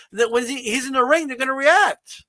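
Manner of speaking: ordinary talk, nothing marked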